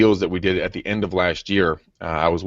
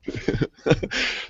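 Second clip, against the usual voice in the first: breathy laugh; 8 semitones higher, 6 dB quieter than usual. Real, not acted. Laughing at the joke.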